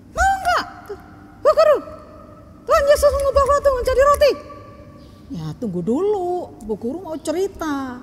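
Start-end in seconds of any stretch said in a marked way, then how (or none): 0.1-4.6 put-on high, loud childlike question, quick at the end; a child eager to hear the answer; curious little girl. Child asks.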